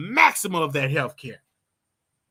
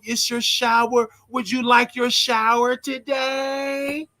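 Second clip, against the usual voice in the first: high, slow, sugary nurse voice greeting each resident; sing-song caregiver greeting; 6 semitones higher, slower than usual. Retold or imitated. Nurse greeting residents at their doors, sing-song and high. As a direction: lilt it like a cheery nurse at the door.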